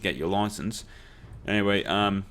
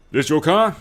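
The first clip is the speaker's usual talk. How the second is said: loud question; 7 semitones higher, 9 dB louder than usual. Retold or imitated. Cop questions him at car.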